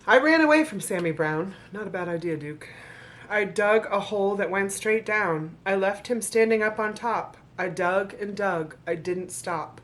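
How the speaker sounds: rhythmic storybook reading to an audience; story narration. Acted. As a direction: read the rhymes like a bedtime book. Reads the picture book aloud.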